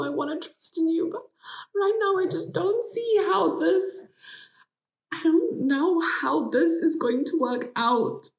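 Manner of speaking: high, loud, desperate pleading; distressed outburst. Retold or imitated. Wife confronting husband over lost job, high anguished pleading. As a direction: pour out the panic at him.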